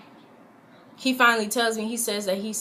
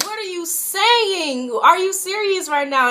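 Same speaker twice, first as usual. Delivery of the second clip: high, loud outraged disbelief; 9 semitones higher, 6 dB louder than usual. Retold or imitated. To her boyfriend about Crystal, outraged and high. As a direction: demand an answer, stunned and angry.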